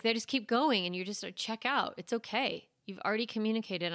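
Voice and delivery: ordinary talk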